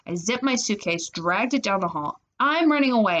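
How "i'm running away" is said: higher, raised declaration; defiant announcement. A narrator's reading. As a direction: announce it to the whole family. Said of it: "I announced".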